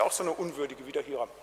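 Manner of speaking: ordinary talk, nothing marked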